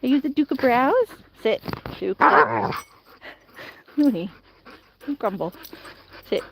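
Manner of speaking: normal talking voice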